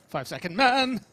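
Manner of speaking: loud, high joking announcement; excited. Real, not acted. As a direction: proclaim it like a title. Jokingly dubbing a nickname.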